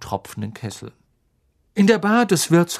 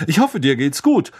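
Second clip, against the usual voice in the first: pompous, formal greeting, a bit louder; 3 semitones higher, 5 dB louder than usual. A narrator's reading. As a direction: play it like a self-important official. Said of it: "sagte Percy pompös".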